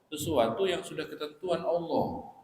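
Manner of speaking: usual speaking voice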